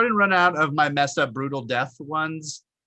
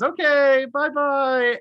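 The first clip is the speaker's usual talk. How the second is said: sing-song, much higher; mock sweet goodbye; 10 semitones higher, 4 dB louder, much slower than usual. The traitor girl bidding her victim a mock sweet farewell. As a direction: chirp it cheerfully as she kills him. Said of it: "And she just goes".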